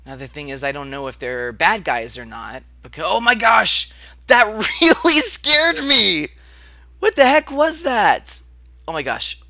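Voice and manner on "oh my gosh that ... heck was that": sudden high frightened shriek; scared shriek at a jump scare; startled scream. Real, not acted.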